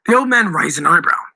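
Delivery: ordinary delivery, nothing marked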